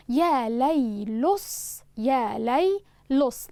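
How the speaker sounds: ليلص drawn out very slowly, the lam joined to the sad; syllable by syllable. Shown as a demonstration. Teacher showing students the scansion of يا ليلص, very slow. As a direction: spell out يا ليلص in slow separated beats. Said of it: "لاحظوا هنا أن حرف اللام".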